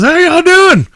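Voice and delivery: high, loud party shout; hype stage greeting. Retold or imitated. The comedian greets the crowd with a high, loud hype shout. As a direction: fire up the whole crowd.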